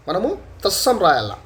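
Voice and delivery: usual speaking voice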